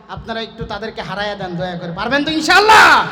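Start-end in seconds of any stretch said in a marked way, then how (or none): 1.9-3.1 loud, raised call swelling on the last word; rousing shout. Real, not acted. Preacher urges brothers to beat the others, shouting. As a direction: fire up the crowd with a shout. Urging crowd to win contest.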